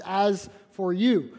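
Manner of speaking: slow, weighty As for you; mild solemn warning; slow solemn rebuke. Retold or imitated. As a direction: let As for you land heavy and slow. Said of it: "the Holy Spirit ... says".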